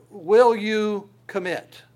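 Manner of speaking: loud, high, slow appeal; calling out. Retold or imitated. Preacher voicing God to the church, calling out. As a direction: call it out slowly as God's challenge. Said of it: "calling out".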